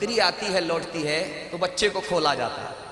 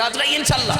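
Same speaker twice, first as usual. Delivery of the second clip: raised, loud call to the congregation; rallying call; 6 semitones higher, 6 dB louder, faster than usual. Real, not acted. Preacher urging the congregation to pledge, rallying call. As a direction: call out high for a show of assent.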